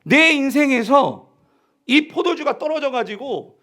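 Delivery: sustained high-pitched, forceful sermon delivery; impassioned preaching. Real, not acted. Preacher telling the congregation of the servants' greater joy, impassioned. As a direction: build the point with ringing conviction.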